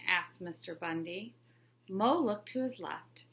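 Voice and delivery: ordinary delivery, nothing marked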